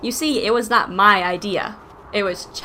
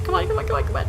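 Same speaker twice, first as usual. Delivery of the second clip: urgent pleading; 10 semitones higher, much faster than usual. Real, not acted. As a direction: come on, come on, high and tense. Urging game to work.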